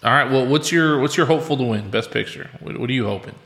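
normal talking voice

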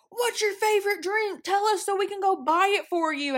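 high-pitched, gushing offer; pushy, chirpy mock enthusiasm; eager stranger. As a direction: imitate an overeager new friend.

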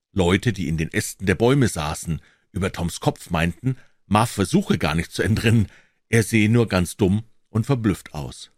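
ordinary delivery, nothing marked

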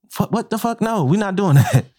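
high flustered coworker laughing it off; backpedaling coworker. Retold or imitated. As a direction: pitch it up, caught out and backing off. Cook backs down from fight.

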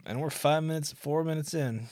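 usual speaking voice

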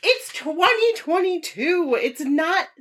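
high, incredulous retort, slowing down; acted disbelief. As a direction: spell it out slowly in high-pitched disbelief. Acts out her shocked reaction.

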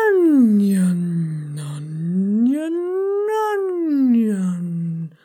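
ONION drawn out long, gliding down then swooping up; sliding pitch warm-up. Shown as a demonstration. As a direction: stretch ONION slowly on a gliding siren. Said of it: "The word onion".

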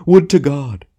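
high, loud theatrical outcry; hammed-up cry to heaven; anguished tragedian. A narrator's reading. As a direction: declaim it in grand despair. Tragedian continues his choked lament.

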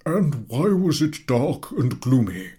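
slow, high questioning; probing professor. A narrator's reading. Professor pressing the third boy, slow. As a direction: lead the pupil on.

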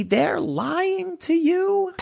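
high, very slow stretched warning; ominous drawn-out warning; drawn-out emphasis. Real, not acted. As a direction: lean hard on every word. Warning viewers about cable news.

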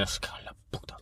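barely audible whispered curse; hushed, swallowed mock swearing; imitated dad's muttering. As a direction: grumble under your breath like an irritated dad. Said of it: "¿Es murmurador o qué?".

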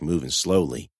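plain reading voice

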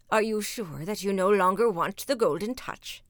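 lightly voiced questioning wizard; a hint of a probing wizard.

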